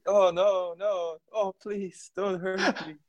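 high mock begging for mercy; mock pleading. Captured Emperor's Children marine begging torturer, hammed-up high plea. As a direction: plead like a terrified captive, hammed up. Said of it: "And they would be like".

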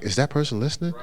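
hushed aside to oneself; preacher's private doubt. Acted. Preacher wondering if that person is listening, quiet doubt. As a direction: wonder it softly under the preaching. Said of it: "you preaching and you're like".

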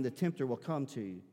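normal talking voice

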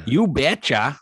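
put-on punchy Minnesota accent "You betcha". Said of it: "Minnesota accent".

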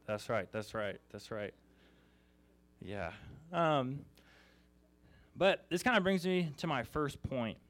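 ordinary talk

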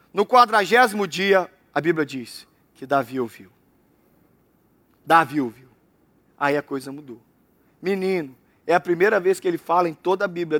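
ordinary delivery

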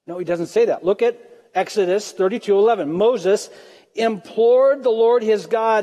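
ordinary talk, nothing marked

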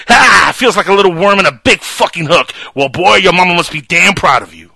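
loud taunting villain impression; movie villain quote. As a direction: sneer it down at the boy. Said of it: "Love that scene".

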